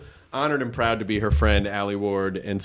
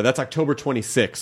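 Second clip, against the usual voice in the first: usual speaking voice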